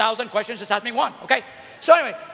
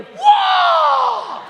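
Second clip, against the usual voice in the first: a huge high shouted whoa, way up in pitch; brain-melting whoa; 4 dB louder than usual. Pretend. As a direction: yell it like a mind blowing apart. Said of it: "watch their brains go".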